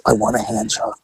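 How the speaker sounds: low, soft embarrassed reply; quiet. Retold or imitated. His own reply, low and soft.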